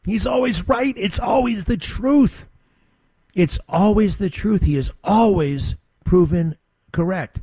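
high-pitched excited exclamation, retold; excited realization. Host retells his reaction to friend's insight, excited and high-pitched. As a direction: re-enact the eureka moment, pitch way up. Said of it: "And I'm like".

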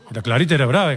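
ordinary talk, nothing marked

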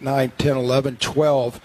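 normal talking voice

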